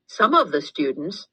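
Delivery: usual speaking voice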